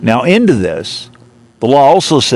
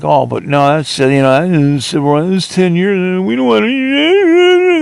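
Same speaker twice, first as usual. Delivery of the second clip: mock whining; 5 semitones higher than usual. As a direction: ham up officials whining. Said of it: "they start whining".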